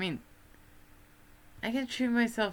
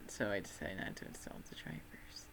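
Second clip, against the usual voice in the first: whisper; 4 semitones lower, 10 dB quieter than usual. Real, not acted. Whispered aside.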